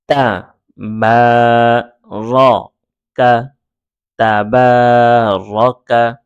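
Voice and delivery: slow, long-held syllables, then joined; teacher's drawn-out recitation; syllable-by-syllable reading. Shown as a demonstration. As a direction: sound out each syllable, then blend them. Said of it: "বানান ছাড়া try করব".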